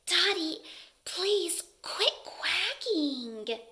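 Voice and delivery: slow, gentle little plea; quiet quail. A narrator's reading. Quiet quail asks noisy duck to stop, soft plea. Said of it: "Quincy's voice is too quiet".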